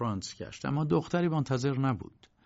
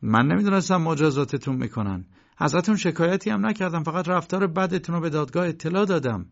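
protesting; 5 semitones higher, 5 dB louder than usual. Acted. K protests to the guards.

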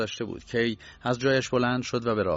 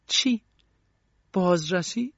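sharp, much higher astonished cry; startled surprise; 9 semitones higher, 4 dB louder, slower than usual. Acted. Young woman learning police searched her room, astonished. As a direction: jump at the news in disbelief. Hears of the inspection.

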